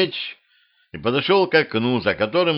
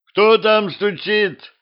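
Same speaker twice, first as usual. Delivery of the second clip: high, slow call from behind a door; a king calling out from his chambers; 11 semitones higher, 3 dB louder, slower than usual. Acted.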